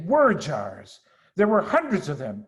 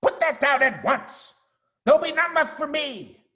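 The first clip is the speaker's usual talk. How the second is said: indignant shriek; 12 semitones higher, 4 dB louder than usual. Acted. Rat scolding intruders.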